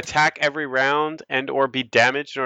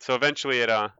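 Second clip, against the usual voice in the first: ordinary talk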